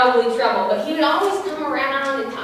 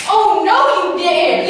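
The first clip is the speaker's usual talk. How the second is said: sassy outrage; 7 semitones higher, 5 dB louder, slower than usual. Retold or imitated.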